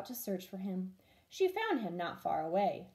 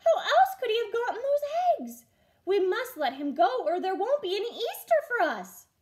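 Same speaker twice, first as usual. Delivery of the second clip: put-on higher, louder, childlike and eager; awed children; 11 semitones higher, 6 dB louder than usual. Children beg the farmer to free the bunny, excited. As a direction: urge the farmer, full of wonder. Children keep exclaiming in wonder.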